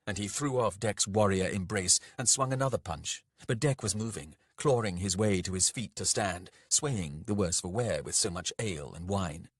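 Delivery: usual speaking voice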